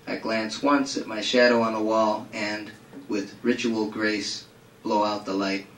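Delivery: low, measured recital with deliberate pauses; subdued prose-poem delivery, easing down at the end; literary reading. Acted. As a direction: read it gravely, letting each image land. Reads a literary passage aloud.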